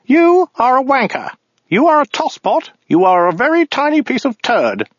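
high, prissy alien delivering insults; sneering little bureaucrat, pitched high; weaselly voice. Acted. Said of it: "weaselly voice".

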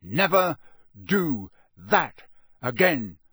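NEVER... DO... THAT... AGAIN, each word punched; slow and loud, a pause after each word; each word separately. Acted. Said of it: "speaking each word as the ferret hit".

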